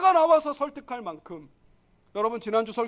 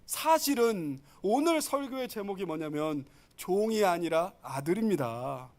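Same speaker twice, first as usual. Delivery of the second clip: ordinary talk, nothing marked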